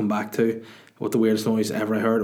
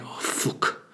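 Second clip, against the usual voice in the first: put-on breathy English-accented grunted curse; mock straining Englishman; 5 dB quieter than usual. Comic mimics English stranger on toilet, strained English accent. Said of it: "It's an English guy".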